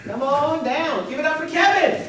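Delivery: high, loud emcee call; excited host call. Real, not acted. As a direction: hype up the next poet. Host calling next poet up.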